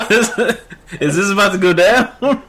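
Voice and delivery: laughing. Real, not acted. Laughing at awkward game scene.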